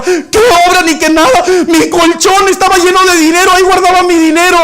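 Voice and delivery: high, loud wailing protest; anguished beggar. Acted. Beggar crying to the youths that his money burned, high and loud. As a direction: cry out over the lost money.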